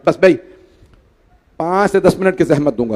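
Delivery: ordinary talk, nothing marked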